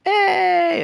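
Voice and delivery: very high, loud hey; Michael following, belted; mock Michael hey. Retelling The Office, Michael's very high hey. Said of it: "so he's like".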